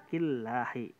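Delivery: Killahi with a very light, thin lam. Shown as a demonstration. Teacher demonstrating a very thin lam to students. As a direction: keep the lam in Killahi as thin as you can. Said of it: "Tipis banget itu".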